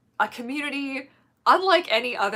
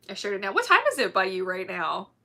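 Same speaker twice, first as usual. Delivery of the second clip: ordinary delivery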